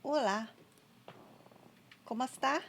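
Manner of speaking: greeting a player in halting, clumsy Spanish; hesitant learner's Spanish, accent not quite there. Real, not acted. Greeting a passing player in self-confessed bad Spanish, clumsy accent. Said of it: "I probably have the worst, worst accent".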